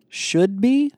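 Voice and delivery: high, loud, rising question; alarmed query. A narrator's reading. Paul queries whether the clothes will arrive, alarmed. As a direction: echo it back sharply upward in disbelief. Startled query of a risky promise.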